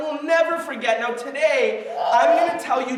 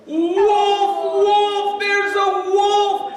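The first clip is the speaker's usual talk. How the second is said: higher, louder drawn-out cry; screaming alarm; 7 semitones higher, 6 dB louder, much slower than usual. Acted. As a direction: call out to the whole valley. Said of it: "he screams at the top of his lungs".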